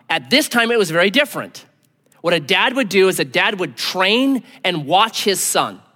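ordinary delivery

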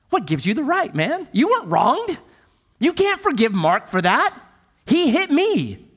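high, outraged objection; indignant protest. Pretend. Punched man protests to the forgiver, high and indignant. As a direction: push back hard, you got hit. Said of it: "What would you say to me?".

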